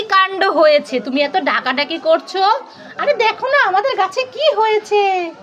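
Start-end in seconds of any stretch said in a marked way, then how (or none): 3.0-5.3 put-on high, eager kid voice; bursting to show mum something; excited child. Child urging mummy to come see, high and excited.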